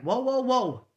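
loud, high mock warning; half-playful whoa to shut it down; retold argument. Retelling arguing with someone who insults New York, loud warning. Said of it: "I'd argue with someone like".

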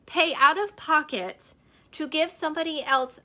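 normal talking voice

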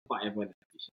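soft, low, laid-back; relaxed blissful murmur. Retold or imitated. Picturing loving the rain without worrying about meetings, soft murmur. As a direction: murmur it, fully at ease.

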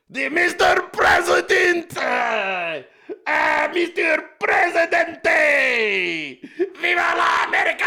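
high, slow, stretched fanboy shouting with a Spanish flavour; over-the-top Milei shout. Retold or imitated. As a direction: belt out the greeting like a starstruck superfan. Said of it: "he sounded like Cheech Marin".

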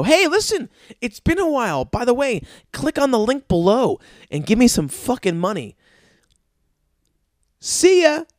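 high, sing-out fake friendliness; mock breezy request. Imitating a once-a-year friend texting for money, mock breezy. Acting out a shameless text request.

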